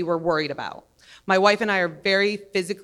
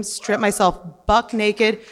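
ordinary talk, nothing marked

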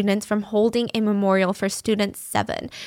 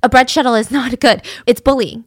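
louder, indignant protest with a short laugh; outraged objection; 7 dB louder than usual. Retold or imitated. Politician outraged at his colleague, louder indignant protest. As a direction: push back hard, scandalised. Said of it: "the other politician is outraged".

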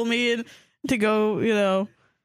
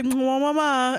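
big wet smooch; 5 semitones higher, slower than usual. Retold or imitated. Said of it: "Jesus Christ is like".

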